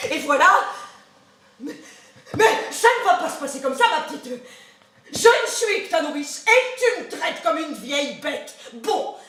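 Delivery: plus fort, indigné, avec des éclats; colère indignée. Acted. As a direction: monter en colère, vexée. Colère de la nourrice. (la nourrice vexée gronde sa petite, en colère)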